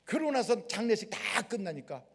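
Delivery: normal talking voice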